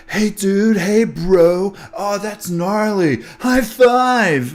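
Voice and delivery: high-pitched mocking bro voice; mock dude-bro. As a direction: imitate over-hyped dudes, pitched well up. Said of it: "they were all like".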